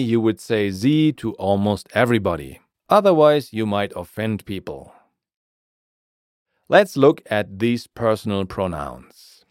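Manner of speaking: normal talking voice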